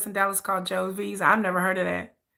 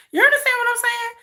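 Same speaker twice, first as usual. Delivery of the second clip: urgent high question; 12 semitones higher, faster than usual. Real, not acted. Pressing viewers to agree.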